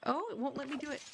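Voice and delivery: ordinary talk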